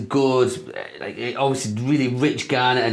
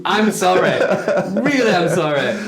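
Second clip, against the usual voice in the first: higher, louder, giggling apology; laughing apology; 5 semitones higher, 4 dB louder than usual. Real, not acted.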